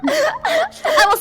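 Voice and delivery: giggling it out, amused at herself; laughing. Real, not acted. Contestant laughing about her date. Laughing while recalling fear.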